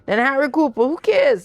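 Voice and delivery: high, eager brush-off; giddy and impatient, talking over him; excited dismissal. Acted. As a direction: wave the objection away, thrilled. Wife brushes off husband's objection.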